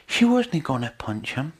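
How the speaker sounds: ordinary delivery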